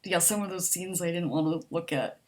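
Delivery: ordinary talk, nothing marked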